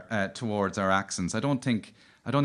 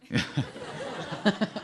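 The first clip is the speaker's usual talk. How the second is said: breathy giggle; 9 semitones higher, 4 dB quieter than usual. Real, not acted. Laughing at radio play parody.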